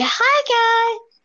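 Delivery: high bright channel intro; bubbly YouTuber greeting. Retold or imitated. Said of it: "And we're doing a".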